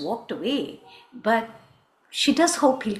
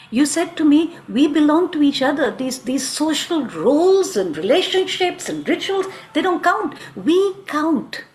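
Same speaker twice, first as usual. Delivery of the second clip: louder, fervent lover's argument; impassioned insistence; 3 semitones higher, 5 dB louder than usual. Acted. Krishna reminds Radha they belong to each other, fervently. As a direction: drive each point home urgently.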